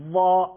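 loud, full "ظا" with a thick, dark dh; heavy za. Shown as a demonstration.

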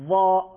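loud, full "ظا" with a thick, dark dh; heavy za. Shown as a demonstration.

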